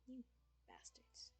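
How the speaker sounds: whispered insult. Real, not acted. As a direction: breathe the insult out unvoiced.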